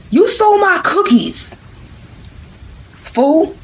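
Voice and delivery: high, slow, drawn-out accusation; indignant accusation. Acted. Bobby confronting Algebra over stolen cookies, indignant. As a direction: squeal the charge, stretching it out.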